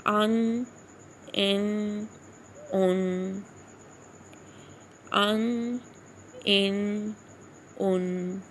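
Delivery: "ان" with the n held in the nose; long humming n closing each "ان"; nasal ghunnah. Shown as a demonstration. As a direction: linger on the nasal n of "ان" at an even pitch. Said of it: "آواز کو ناک میں ٹھہراتے ہیں".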